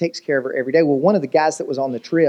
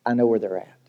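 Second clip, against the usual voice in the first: grave mourner's quote; 7 semitones lower, 4 dB quieter than usual. Acted. Preacher voices the worse answer at a funeral, grave and low. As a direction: lower voice, quietly resigned.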